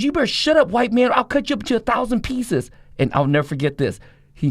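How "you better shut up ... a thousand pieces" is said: high furious yelling at the preacher; angry shout. Retold or imitated. Nicky Cruz threatening the preacher.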